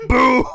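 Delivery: loud voiced boom; mock explosion boom. Retelling the grenade blast with a boom. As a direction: punch out a big boom.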